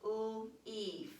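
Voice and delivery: "l-" split off, then "eef" with a long ee; teacher showing the silent A; sounding out. Shown as a demonstration. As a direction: segment "l-, eef" slowly. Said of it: "We don't hear the A".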